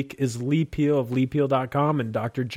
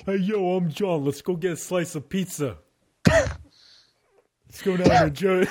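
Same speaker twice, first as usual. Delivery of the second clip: imitating John; 5 semitones higher, slower than usual. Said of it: "I don't even talk like that".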